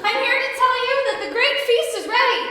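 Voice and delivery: loud, high tearful wail; hammed-up weepy messenger; blubbering servant. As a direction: announce it through loud blubbering. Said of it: "blubbered the third servant".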